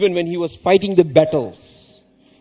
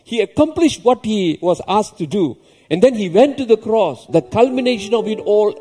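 raised, ringing sermon voice; fervent preaching; 6 semitones higher, 3 dB louder than usual. Real, not acted. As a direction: preach it with rising fervour. Preaching rises above the plain talk.

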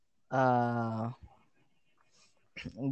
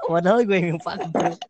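ordinary talk

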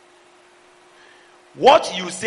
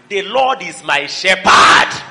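declared shout; 8 dB louder, slower than usual. Acted. Preacher declaring scripture.

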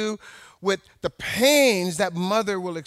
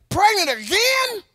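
high, loud scoffing disbelief; mock the news like a gossiping church lady; pitched-up sneering "again?"; incredulous; 12 semitones higher, 4 dB louder than usual. Church ladies mock the pregnancy.

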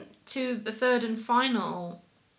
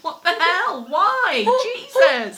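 rising higher and louder into a laughing whimper; shocked disbelief; 9 semitones higher, 9 dB louder, much slower than usual. Retold or imitated. Woman hearing people are being attacked, laughing shock. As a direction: react in laughing horror.

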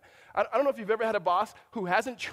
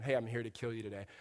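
casual threat; 8 semitones lower, 6 dB quieter, faster than usual. Retold or imitated. Casual killer in imagined chat.

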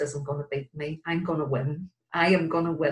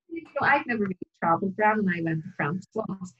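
ordinary delivery

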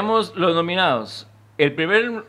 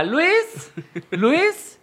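calling out a name; 13 semitones higher than usual. Retold or imitated. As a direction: call the name high and questioning. Imita a la señora llamando.

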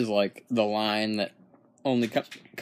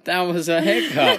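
laughing; 6 semitones higher, 7 dB louder than usual. Real, not acted. Laughing about his hiccup.

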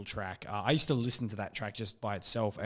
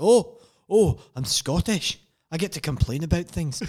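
put-on loud, higher-pitched Scottish accent; hammed-up, indignant Scottish accent; Peter Capaldi impression, Scottish; 7 semitones higher, 8 dB louder than usual. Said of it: "it's a terrible Peter Capaldi impression".

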